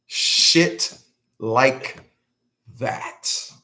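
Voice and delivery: word by word, long pauses between; deliberate repeat. Real, not acted. Man underlining his point to viewers, slow and halting.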